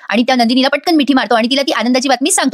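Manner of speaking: ordinary talk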